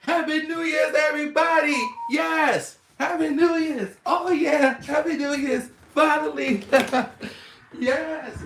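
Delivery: high, loud cheering; excited shouting. Real, not acted. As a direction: yell with joy. Celebration shouts.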